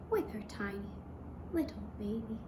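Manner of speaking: ordinary delivery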